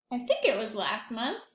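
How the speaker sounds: ordinary delivery, nothing marked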